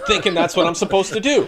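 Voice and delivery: chuckling at his own blunder; laughing. Real, not acted. Laughing while telling friends about the pill mix-up, laughing.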